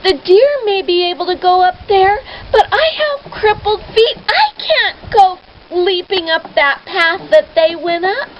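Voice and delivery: high tearful plea, voice breaking; tearful whimpering complaint; crying. Acted. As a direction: cry it out as a frightened girl. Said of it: "she just continued to cry".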